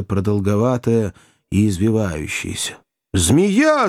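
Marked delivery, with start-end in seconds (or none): 3.1-3.9 high, sudden cry. A narrator's reading. Joe warns companions of a snake, crying out. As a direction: yelp the word in alarm. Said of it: "закричал Джо".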